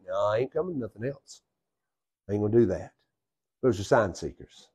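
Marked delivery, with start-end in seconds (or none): 0.1-2.9 much softer, dismissive no; a quiet, stubborn refusal to come; 1 blunt refusal. Acted. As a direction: mutter the refusal flatly. Acted flat refusal.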